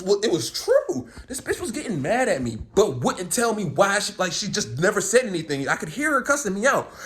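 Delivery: normal talking voice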